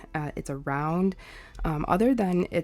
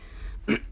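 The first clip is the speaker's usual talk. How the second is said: throat clearing. Real, not acted. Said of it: "excuse me".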